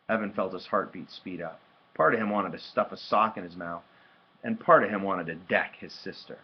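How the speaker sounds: plain reading voice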